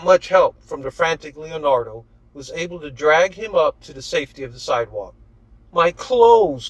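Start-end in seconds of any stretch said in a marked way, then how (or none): 5.7-6.7 complaining whine. A narrator's reading. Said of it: "complained Leonardo".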